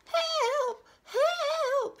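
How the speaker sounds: very high, loud drawn-out squeal; acted high-pitched distress call; crying for help. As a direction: squeal for rescue, stretching each cry. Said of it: "he cried".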